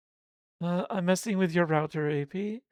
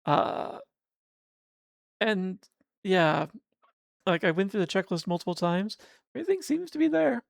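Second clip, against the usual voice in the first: usual speaking voice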